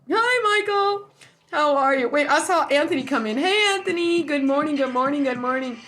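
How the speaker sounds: high, loud hellos called across the room; loud cheery greeting. Real, not acted. Greeting neighbors walking in, a loud high hello. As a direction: call out to each friend, bright and high.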